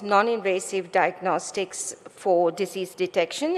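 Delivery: ordinary talk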